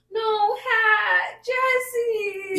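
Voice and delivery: put-on high, loud toddler protest; mock little-kid cry; imitating upset toddler. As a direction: wail like a kid whose hat was taken. Imitating toddler wanting hat back.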